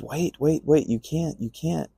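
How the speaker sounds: lightly acting out an urgent stop; urgent plea. Founder stopping the visitor leaving, mildly urgent plea.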